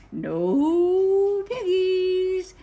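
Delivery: very slow, high, loud mooed words; mooing cow. A narrator's reading. Cow answers Grinch, mooing loudly. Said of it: "mooed the cow".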